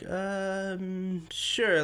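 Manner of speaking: a hesitant, grudging yes, lightly acted; reluctant manager. Museum manager grudgingly answers a photo request, reluctant. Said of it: "the manager was like".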